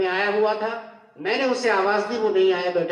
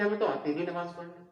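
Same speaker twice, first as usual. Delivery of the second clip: a hint of a sheepish son, softer and quick; lightly mocked excuse; 2 semitones lower, 9 dB quieter, faster than usual. Preacher mocking the son who can't pray, softly. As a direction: toss off the excuse in a small voice.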